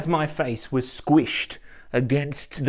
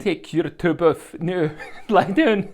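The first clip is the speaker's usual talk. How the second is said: slow Polish attempt that comes out Scottish; botched Scottish-sounding accent, laughing through it; accidental Scottish accent; 4 semitones higher, slower than usual. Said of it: "That's Scottish".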